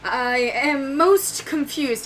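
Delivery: mildly bewildered knight; lightly voiced Braxis. Waking knight to his sister, slow and dazed.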